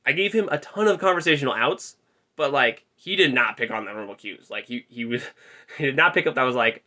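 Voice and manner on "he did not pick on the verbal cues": ordinary delivery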